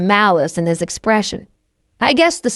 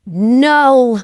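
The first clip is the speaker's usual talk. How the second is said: a touch of a hiss, sharp and a bit louder; 3 semitones higher, 4 dB louder than usual. A narrator's reading. Narrator refusing the captive, mild hissed no. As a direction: cut him off with it. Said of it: "I hiss at him".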